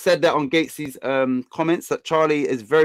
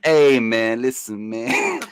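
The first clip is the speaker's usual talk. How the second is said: slow, drawn-out American rapper impression; mock American accent, words stretched out; put-on American accent; 5 semitones lower, much slower than usual. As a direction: play at being an American rapper. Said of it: "Who's T I?".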